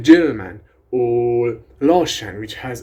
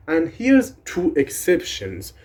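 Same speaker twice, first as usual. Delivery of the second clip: usual speaking voice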